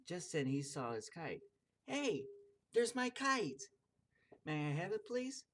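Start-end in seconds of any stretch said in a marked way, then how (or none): 1.9-3.6 put-on high, loud excited child; excited shout. Child spots his kite. 4.4-5.4 lightly voiced eager child.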